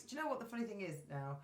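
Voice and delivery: usual speaking voice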